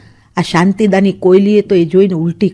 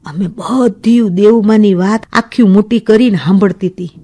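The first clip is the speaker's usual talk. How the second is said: plain reading voice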